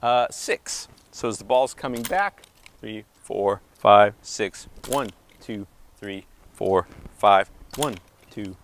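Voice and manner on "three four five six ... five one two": counting out the beats in time with each swing; counting the rhythm. Shown as a demonstration. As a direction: call each count as the ball comes back, landing on five. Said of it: "The first way is to hit on, uh, six".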